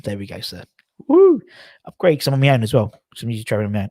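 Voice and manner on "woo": sudden high, loud woo; a much higher, louder cheer of delight; excited whoop. Real, not acted. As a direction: whoop like you just won. Celebrating getting the upgrade.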